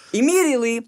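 usual speaking voice

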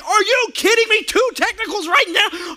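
high, loud mock-furious yell at the ref; outraged yell. Pastor yelling at the ref over the second technical, outraged.

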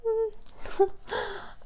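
a high, soft laugh through tears; teary high laugh. Real, not acted. Tearful laugh after near crying.

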